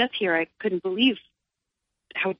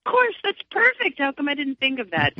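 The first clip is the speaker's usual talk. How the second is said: high, bright mock thrill at a perfect idea; delighted realization; 9 semitones higher, 3 dB louder than usual. Recalling the producer's song ideas, a high delighted squeal. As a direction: squeal it like the idea just clicked. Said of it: "it was like".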